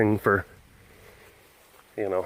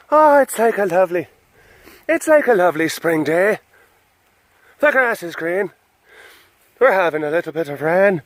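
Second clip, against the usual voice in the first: high, loud hammed-up Irish lilt; comic stage-Irish voice, pitched way up; Irish accent; 7 semitones higher, 3 dB louder than usual. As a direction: put on a silly singsong Irish accent. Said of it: "what kind of accent you're doing".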